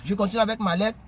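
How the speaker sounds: normal talking voice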